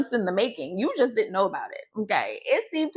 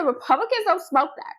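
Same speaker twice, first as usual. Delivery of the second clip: emphatic mock conviction, higher and brisk; said with his chest; 5 semitones higher, faster than usual. Imitating a Southern Republican man laying down the rule, with his chest. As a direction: declare it with quick, total certainty. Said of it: "said it with his chest".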